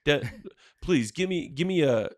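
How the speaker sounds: calling out the scammer; retold angry rant. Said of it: "retold phone call with scammer".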